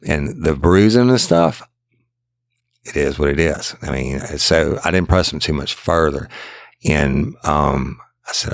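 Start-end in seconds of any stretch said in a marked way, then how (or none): none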